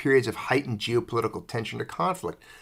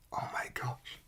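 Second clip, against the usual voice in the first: breathy whispered aside; whispering; 13 dB quieter than usual. Real, not acted. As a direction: whisper it under your breath. Aside reacting to the script.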